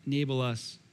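low, soft prayer voice; closing prayer. Real, not acted. Pastor leads closing prayer.

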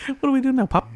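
high eager puppy voice; dog voice. Pretend. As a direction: voice the dog looking up. Voicing the dog Rupert.

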